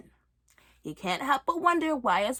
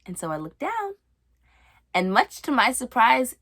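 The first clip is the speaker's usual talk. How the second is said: ordinary talk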